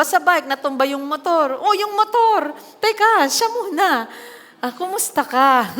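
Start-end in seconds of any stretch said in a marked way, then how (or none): 1.6-5.7 higher, louder mock alarm, laughing at the end; panicked about the motorbike. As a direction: overreact to the bike first, then remember the child. Parent fussing over crashed motorbike. (pabirong pagkataranta, malakas at mataas ang boses)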